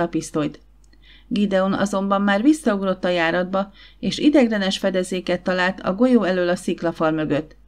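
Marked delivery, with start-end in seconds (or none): none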